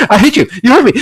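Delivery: ordinary talk, nothing marked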